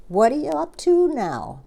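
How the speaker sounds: high-pitched, inquisitive monkey voice; curious monkey. Acted. Monkey quizzing the passing crocodile, curious and high. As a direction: swoop up high on a nosy question.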